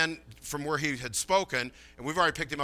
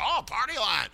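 mock recognition; 7 semitones higher than usual. Acts out listeners recognising the term.

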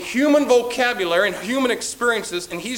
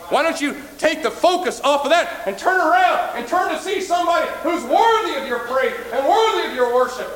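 preaching shout; 8 semitones higher than usual. Real, not acted. Preacher shouting at the congregation, full force. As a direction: call them to turn around.

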